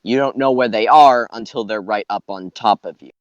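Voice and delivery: ordinary talk, nothing marked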